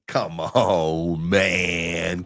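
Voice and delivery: low, drawn-out "come on, man"; hammed-up plea, sustained and low; mock pleading. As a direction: stretch it out down low. Hammed-up plea to spot buyers.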